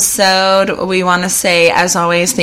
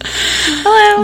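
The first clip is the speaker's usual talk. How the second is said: high put-on hello. Greeting the fan in a voice.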